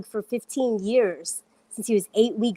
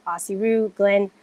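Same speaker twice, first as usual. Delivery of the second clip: normal talking voice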